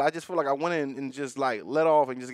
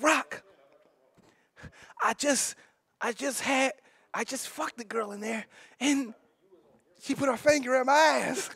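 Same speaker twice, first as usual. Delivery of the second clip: imitating Jake; 9 semitones higher than usual. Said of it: "He was like".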